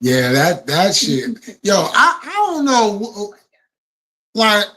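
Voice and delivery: ordinary delivery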